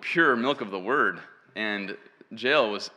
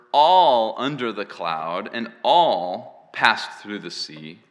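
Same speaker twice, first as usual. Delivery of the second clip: each "all" stretched and punched louder; stress on "all"; 2 semitones lower, 6 dB louder than usual. Shown as a demonstration. Preacher reads Paul to the church, stressing "all". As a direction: lean hard on every "all". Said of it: "heard me emphasize the word all".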